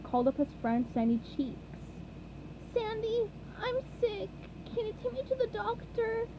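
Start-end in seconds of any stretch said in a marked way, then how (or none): 2.7-6.3 high, quiet sick sponge voice; a high, soft, sniffly little plea; SpongeBob voice. A narrator's reading. SpongeBob character voice.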